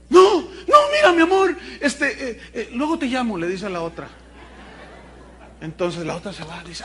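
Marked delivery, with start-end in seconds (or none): 0.1-3.4 high, loud panicky brush-off; flustered husband. Acted. Husband caught, shooing his lover off in a high panic. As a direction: hurry the lover away, flustered.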